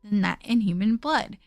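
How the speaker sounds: low, even narration voice; story narration. Acted. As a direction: read the game text calmly. Reads game narration quietly.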